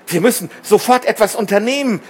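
quick, high and alarmed; urgent cry. A narrator's reading. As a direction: demand action at once. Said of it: "schrie Tom".

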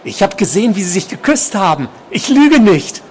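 high voice climbing to an outburst; obsessed patient, agitated. A narrator's reading. Patient swearing he saw them kiss, agitated high voice.

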